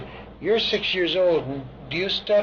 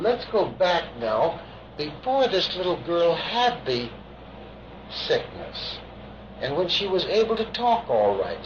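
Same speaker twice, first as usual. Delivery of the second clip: slow hypnotic. Real, not acted. Hypnotist slowing for regression.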